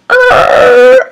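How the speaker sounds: loud, high mock car engine failing to start; imitated engine sputter. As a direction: grind it out like a dying engine. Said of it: "and it's like".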